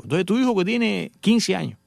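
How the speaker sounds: ordinary talk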